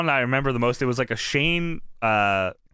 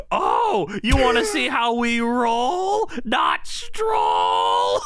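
fired-up shout; 8 semitones higher, much slower than usual. Retold or imitated. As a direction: hype it up like a fired-up commentator. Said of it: "Tenay gets so fired up. He says".

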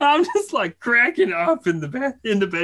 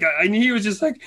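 ordinary talk

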